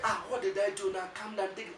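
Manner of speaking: high-pitched mock protest; lightly acted, high female part; protesting girlfriend. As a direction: play her pleading for calm, pitched high. Partner protests in acted quarrel.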